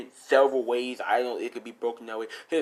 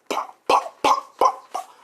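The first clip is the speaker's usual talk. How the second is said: voiceless percussive pops; hard popping hit effects; imitated punches; 4 dB louder, slower than usual. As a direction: spit out a string of punch sounds.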